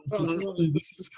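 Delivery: put-on light Austrian strongman accent; a touch of Arnold's Austrian accent; Arnold Schwarzenegger. Host continuing the Arnold impression. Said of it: "It's me, Arnold Schwarzenegger".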